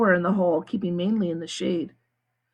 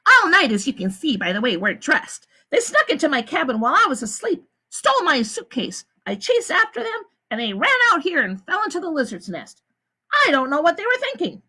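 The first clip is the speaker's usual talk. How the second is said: a high, smooth woman's voice; the warden; 8 semitones higher than usual. A narrator's reading. The warden explains to the visitors, high and smooth. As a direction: lie calmly to the visitors. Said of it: "This is the warden speaking".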